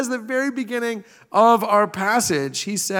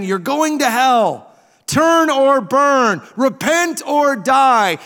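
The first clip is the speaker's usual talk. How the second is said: slow, loud, higher bullhorn yell; street preacher shouting; 4 semitones higher, 5 dB louder, slower than usual. Retold or imitated. Pastor mimicking a street preacher warning passersby, loud slow shout. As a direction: slowly bellow a warning at the passersby. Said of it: "they'll be saying".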